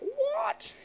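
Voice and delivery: put-on squeaky high, puzzled child's "What?"; a small boy who can't follow; baffled what. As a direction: jump way up in pitch, utterly confused. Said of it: "his little face again is looking like".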